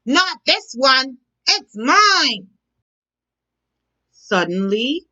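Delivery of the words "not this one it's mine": higher, louder defiant claim; croaked defiantly. Acted. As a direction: clutch the worm and refuse. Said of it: "croaked defiantly".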